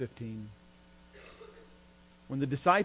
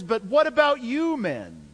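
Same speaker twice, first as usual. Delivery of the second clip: "you men" pitched high and drawn out; pointed emphatic question; 8 semitones higher, 5 dB louder, slower than usual. Retold or imitated. Jesus aims the question straight at his disciples, pointed and emphatic. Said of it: "aims the question straight at them personally".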